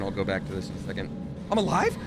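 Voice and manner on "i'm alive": high, loud disbelief; shocked to still be alive; surprised. Real, not acted. Survives unexpectedly in game.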